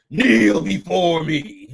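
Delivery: drawn-out, slow imitation of a villain's command; slow, grandiose mock order; mock Boom Tower. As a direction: intone it slowly, hamming up the grandeur. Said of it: "Boom Tower was like".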